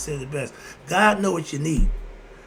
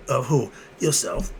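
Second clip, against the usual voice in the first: usual speaking voice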